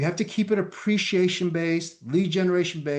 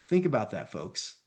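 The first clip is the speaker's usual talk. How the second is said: ordinary talk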